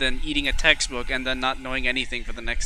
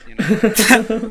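laughing; 8 semitones higher, 8 dB louder than usual. Real, not acted. Laughing at own joke.

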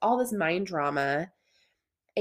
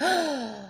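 sharp gasp, pitched up; startled gasp; 6 semitones higher than usual. Retold or imitated. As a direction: gasp like you just saw something. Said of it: "my brain will freak out".

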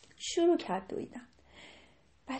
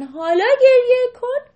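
crying; 10 semitones higher, 12 dB louder than usual. Acted. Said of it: "شروع کرد به گریه کردن".

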